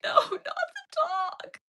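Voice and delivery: high, slow, crying wail of no, not the dog; mock sobbing plea. Child at the movie wails at the boy, high slow mock sob. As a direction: whimper it like a kid losing her pet. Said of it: "I was like".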